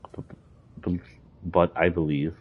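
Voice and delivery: stuttering on "but"; real repeated stutter. Real, not acted. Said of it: "Sorry about the stutter".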